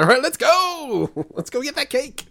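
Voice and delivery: high, eager cheer; excited. Retold or imitated. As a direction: cheer it like a gung-ho hero. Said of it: "And he's like".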